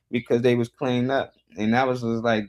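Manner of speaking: ordinary talk, nothing marked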